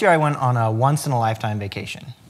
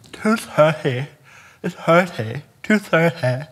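stifled giggles; 7 semitones higher, 6 dB louder, much slower than usual. Real, not acted. Repeated punchline, strained.

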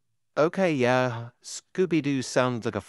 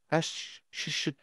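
soothing shush; 4 semitones higher, 6 dB quieter than usual. Acted. Child still crying.

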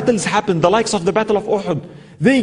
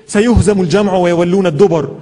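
rapid Arabic verse, rattled off fast; quick Quran recitation; 2 semitones lower, 4 dB louder, much faster than usual. Acted. Preacher quoting Surah Al-Qamar to the listeners, fast recitation.

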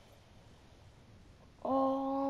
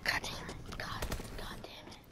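softer, breathier second curse; whispered curse; 15 dB quieter than usual. Real, not acted. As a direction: mutter it again even quieter. Repeated curse, second take.

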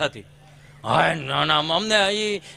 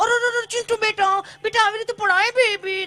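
much higher cooing mother voice; fussy city mother; 13 semitones higher than usual. Retold or imitated. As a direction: coo over the fallen boy. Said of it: "તે mummy પાછી".

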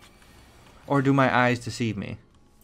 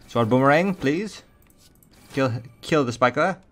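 hammed-up, vaguely European accent, lifting in pitch; crappy French accent; 3 semitones higher, 4 dB louder than usual. Player ordering an attack on the Spiker, in crappy French accent. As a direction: slip into a bad French accent. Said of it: "a really crappy French accent".

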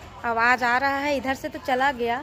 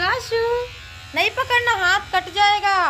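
much higher, louder cry of warning; 9 semitones higher, 5 dB louder than usual. Real, not acted. Warning child not to grab.

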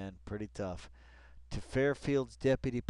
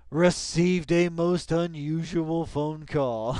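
louder, higher, slow ominous read; mock dramatic reading; 6 semitones higher, 10 dB louder, slower than usual. Reading the article aloud, a hint of mock drama. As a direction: hype up the line.

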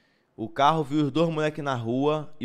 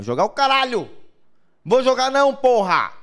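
louder, higher angry outburst; 7 semitones higher, 6 dB louder than usual. Real, not acted. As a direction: shout the refusal, fed up. Furious refusal to play. (gritando bravo, recusando)

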